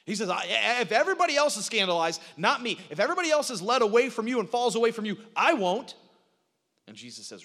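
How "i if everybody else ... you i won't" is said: loud, high, fast protest; insistent vow. Retold or imitated. Peter swearing he will outlast everybody else, insistent vow. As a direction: insist hotly, pushing the pace. Peter boldly vows his loyalty.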